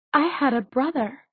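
high, subdued, near tears; voice catching. A narrator's reading. Daughter takes in her lost brother, voice catching. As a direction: say it with a small catch in the voice. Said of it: "her voice catching".

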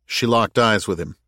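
plain reading voice

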